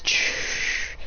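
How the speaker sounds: a soft breathy hiss of dust; hissing shh. Retold or imitated. Villain turns to dust, soft hissing shh. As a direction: let it fade out as a hiss. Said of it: "He goes".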